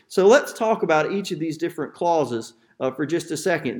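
usual speaking voice

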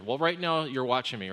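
ordinary delivery

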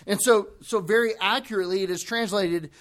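ordinary delivery

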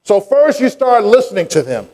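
high, ringing preacher's cadence; fervent sermon delivery; preaching. Acted. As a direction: preach it out to the congregation. Preaching at full force.

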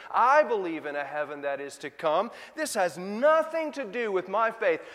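a hurt believer pushing back, rising to a shout; indignant protest. Acted.

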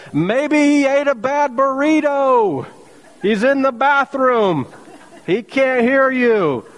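high, slow mock-sympathetic ribbing; sarcastic taunt. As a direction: rub it in with fake concern. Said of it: "sarcasm is clearly a spiritual gift".

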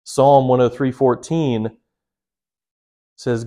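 ordinary talk

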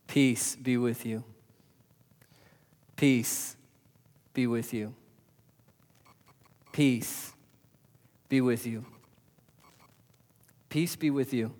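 soft and slow, peace lingering; gentle blessing. Acted. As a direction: speak it like a benediction. Jesus' greeting, repeated softly.

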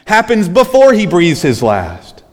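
high, loud impassioned preaching; preaching at full force. Acted. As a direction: cry it out to the congregation. Preaching climax.

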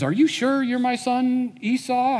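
high, suspicious question; a father quizzing his son, pitched high; doubtful father. Retold or imitated.

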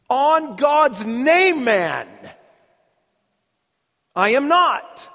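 loud, high, drawn-out oath; sworn denial. Acted. Peter denying Jesus with an oath, loud and emphatic. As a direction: swear it forcefully, stretching the oath. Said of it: "this time with an oath".

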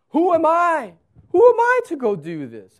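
high, loud incredulous Moses; Moses objecting. Acted. Moses questioning God's call, high and loud. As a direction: protest in disbelief. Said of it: "Moses says".

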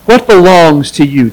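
ordinary talk

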